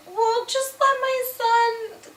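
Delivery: high, drawn-out put-on plea; mock-pleading Cleopatra voice; pleading queen.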